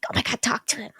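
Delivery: whispered, pressing her friend to talk; a friend's excited whisper from the driver's seat; urgent whisper. Retold or imitated. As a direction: hiss it urgently so the boy can't hear. Amy urging friend in car.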